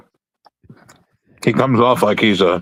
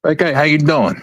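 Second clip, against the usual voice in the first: higher-pitched mock Brooklyn Italian mobster; 5 semitones higher than usual. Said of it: "a Brooklyn Italian accent, they usually think mobster".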